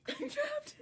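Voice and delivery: high-pitched whiny cry; mock panic. Teasing the sibling stuck in cat tower, mock panic. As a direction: wail in playful panic.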